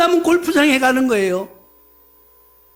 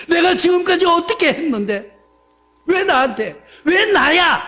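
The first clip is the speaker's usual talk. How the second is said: 내가 pushed higher, rising grievance; indignant complaint; 6 semitones higher, 4 dB louder than usual. Retold or imitated. Believer protests to God about his suffering, indignant. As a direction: protest it, building to 왜 나야. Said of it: "하면서 불만, 불평".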